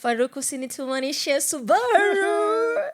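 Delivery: high, loud mock wail; hammed-up catchphrase. Host teasing a political aide, hammed-up mock pleading. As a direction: stretch the last word into a comic howl. Repeats catchphrase jokingly amid laughter.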